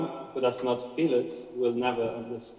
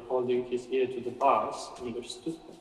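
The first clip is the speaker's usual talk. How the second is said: ordinary delivery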